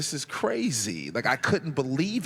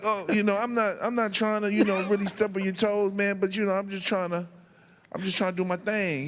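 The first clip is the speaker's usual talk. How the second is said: raised, hurried apologetic pleading; nervous backpedal; 7 semitones higher than usual. Retold or imitated. Rookie apologizing to KG, raised nervous backpedal. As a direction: back down nervously.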